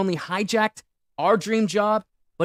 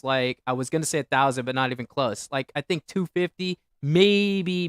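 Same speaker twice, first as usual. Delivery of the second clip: ordinary delivery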